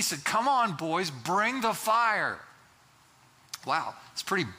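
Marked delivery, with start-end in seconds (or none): 0.2-2.4 loud, drawn-out dare; bold defiance. Acted. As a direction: taunt the executioners slowly and boldly, raising the voice. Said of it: "pretty defiant".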